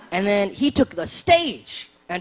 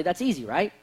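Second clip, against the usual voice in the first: ordinary talk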